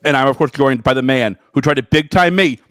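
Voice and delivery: ordinary talk, nothing marked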